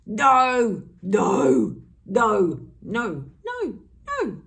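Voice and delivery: loud, firm refusals. Shown as a demonstration. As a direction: shout each no with conviction. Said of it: "what did it feel like to say no".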